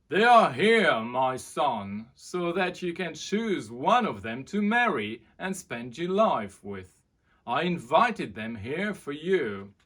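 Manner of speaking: loud, proud royal father; booming king. Acted. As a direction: boom it over the crowd. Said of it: "It was Henry's father".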